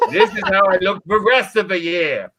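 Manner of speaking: ordinary talk, nothing marked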